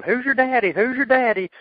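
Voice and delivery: high, loud mocking chant; taunting yell. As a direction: jeer it from the crowd. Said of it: "the guy in the crowd was yelling".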